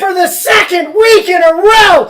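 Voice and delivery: high, loud jubilant cry; shouting praise for the winner; ecstatic shout. Real, not acted. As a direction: proclaim it like a revival preacher. Hyped winner announcement.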